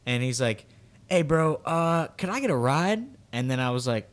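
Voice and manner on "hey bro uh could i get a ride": put-on hesitant teen stranger. Teen stranger asking driver for a ride, hesitant and higher. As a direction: ask a favour, pitched up and stretched out.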